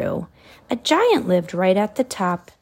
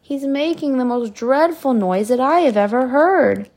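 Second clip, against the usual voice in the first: king crying out; 4 semitones higher, 6 dB louder than usual. A narrator's reading. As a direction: cry out like an exasperated king. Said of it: "The king cried".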